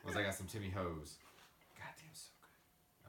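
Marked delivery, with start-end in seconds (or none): 1.8-3.1 slow, hushed savouring; reverent whisper. Real, not acted. Recalling Timmy Ho's to co-host, slow savouring whisper. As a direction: whisper it, lost in the memory of the food.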